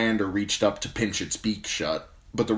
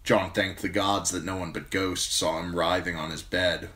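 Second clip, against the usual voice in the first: plain reading voice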